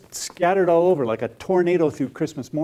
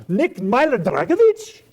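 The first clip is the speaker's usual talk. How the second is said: high, slow, rising question; incredulous; 9 semitones higher, 5 dB louder, slower than usual. Acted. Artist learning Nick is a real person, incredulous. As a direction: draw the name out in loud disbelief.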